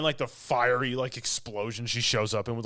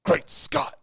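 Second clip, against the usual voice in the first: Doc Brown impression; 9 semitones higher, 4 dB louder than usual.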